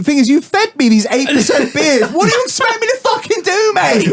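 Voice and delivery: high, laughing indignation; exasperated protest. Real, not acted. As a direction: protest helplessly, pitch climbing, half laughing. Mock protest about strong beers.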